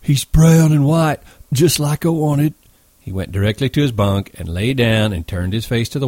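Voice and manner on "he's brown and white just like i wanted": high, breathless character voice; slow, high and excited, as if short of breath. A narrator's reading. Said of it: "Lenny said breathlessly".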